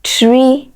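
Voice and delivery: "tree" pitched high and drawn out; stressed syllable. Shown as a demonstration. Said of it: "it is the second syllable".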